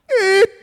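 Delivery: loud high imitated horn blast; air horn. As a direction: honk like a small air horn. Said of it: "one of them baby ones".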